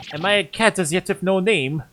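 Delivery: theatrical grand author; indignant author. Acted. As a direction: puff up like a wounded writer.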